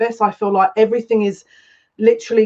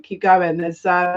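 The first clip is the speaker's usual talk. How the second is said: normal talking voice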